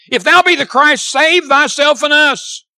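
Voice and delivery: loud, high mocking demand; railing taunt. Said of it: "railed on him".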